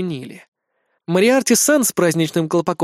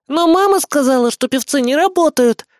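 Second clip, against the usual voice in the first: put-on high, loud little-girl protest; 11 semitones higher, 5 dB louder than usual. A narrator's reading. Small girl at the party table contradicting the captain, childish protest. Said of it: "возразила одна из ... маленьких девочек".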